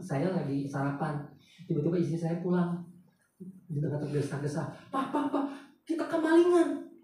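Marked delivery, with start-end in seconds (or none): 4.9-6.9 panicked wife. Retold or imitated. Wife rushing home to tell husband of the theft, alarmed. Wife bursts in, house robbed.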